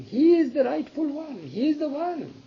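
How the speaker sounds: high, ringing insistence; emphatic insistence. Acted. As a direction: press the point hard, pitch soaring. Queen insists to reluctant king.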